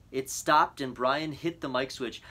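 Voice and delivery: plain reading voice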